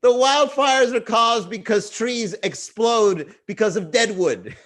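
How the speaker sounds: put-on high, slow laughing kid voice; mocking child. Child laughing at dad's wildfire post, high kid voice. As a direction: giggle at dad's wildfire claim.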